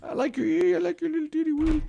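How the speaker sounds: put-on high squeaky baby-talk voice. Shown as a demonstration. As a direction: coo it like talking to a baby. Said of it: "a little baby talk".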